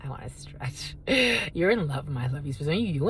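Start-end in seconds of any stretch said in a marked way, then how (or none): 0.0-0.9 a tiny whispered voice for the pet. Pretend.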